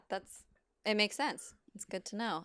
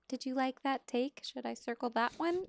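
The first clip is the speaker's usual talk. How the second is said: tentative asking; 7 semitones higher, 4 dB quieter than usual. Retold or imitated. Script supervisor checking with a busy director, tentative and higher. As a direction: check timidly, voice lifting at the end.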